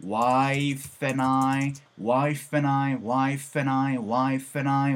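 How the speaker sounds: "wife and I" joined as waife n ai; linked "wife and I". Shown as a demonstration. Teacher demonstrating the linked sound of wife and I. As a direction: glide wife into and, drop the d. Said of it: "wife and I 이렇게 들리시죠?".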